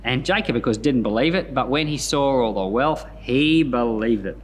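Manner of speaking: ordinary delivery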